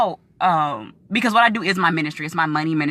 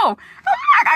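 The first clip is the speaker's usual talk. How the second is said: laughing shout; 5 dB louder than usual. Real, not acted. Laughing reaction to offer.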